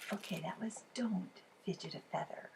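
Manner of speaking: plain reading voice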